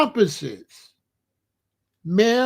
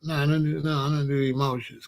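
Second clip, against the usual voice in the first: ordinary talk, nothing marked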